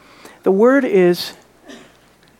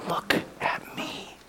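hushed whisper, barely voiced; 16 dB quieter, slower than usual. Preacher whispering Jesus' words to the congregation, hushed. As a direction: lean in and breathe it to the crowd.

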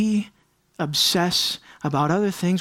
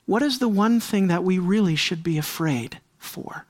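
normal talking voice

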